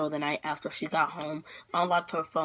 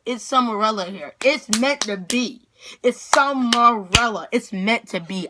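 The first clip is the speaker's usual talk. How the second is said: retold heated insistence; 3 semitones higher, 6 dB louder than usual. Daughter to dad about meeting her idol, loud insistence. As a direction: hammer each word loudly in hammed-up outrage.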